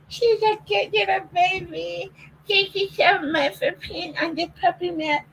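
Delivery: put-on very high, soft, slow doggy baby talk; squeaky pet-talk thanks; baby voice praise. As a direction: coo praise at the puppy.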